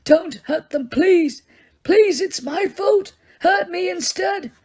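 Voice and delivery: high, slow pleading that swells to a shout; old man begging in anguish; anguished moaning plea. Acted. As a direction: beg them to spare others. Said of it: "as he moaned".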